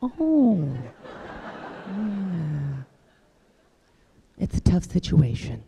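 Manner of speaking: high pitched awkward "oh", fading down; awkward sympathy. Retold or imitated. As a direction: trail off uncomfortably, unsure.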